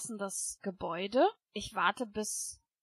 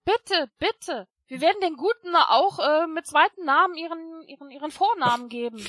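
desperate begging; 6 semitones higher, 9 dB louder than usual. Acted. Begging the janitor.

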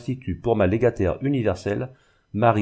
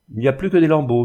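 plain reading voice

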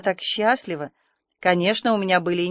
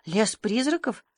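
slow, stunned echo question; dumbfounded; 4 dB quieter, slower than usual. A narrator's reading. Marilla hears of the haunted wood, dumbfounded. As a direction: repeat it slowly in disbelief. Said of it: "Марила остолбенела".

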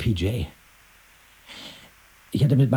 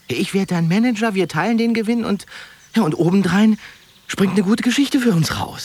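much higher, louder eager sales pitch; excited pitch; 9 semitones higher, 6 dB louder than usual. Acted. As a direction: sell the dream with fire.

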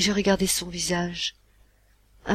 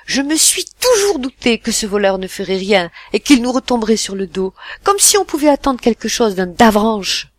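much louder, higher, furious scorn; suddenly furious; 4 semitones higher, 10 dB louder than usual. Acted. Mother raging at her husband about the brother, furious. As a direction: spit out contempt for the thief. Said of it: "devenue tout à coup furieuse".

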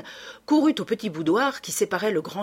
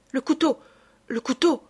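urgent; 6 semitones higher than usual. A narrator's reading. Wounded count cries out urgently.

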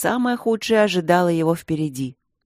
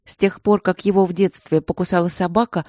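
plain reading voice

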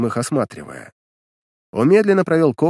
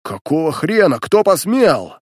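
outraged shout; 4 semitones higher, 5 dB louder, slower than usual. Acted. Коготь outraged by attack.

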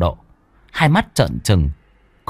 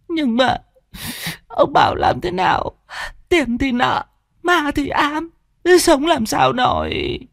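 despairing wife. Acted.

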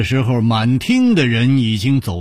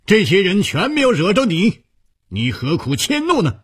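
high-pitched indignant rebuke; indignant, raised-pitch reproach; angry protest; 7 semitones higher than usual. A narrator's reading. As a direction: protest angrily, pitch jumping up then easing. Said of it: "大怒道".

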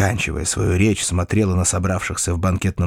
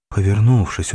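plain reading voice

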